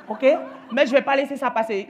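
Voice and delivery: ordinary delivery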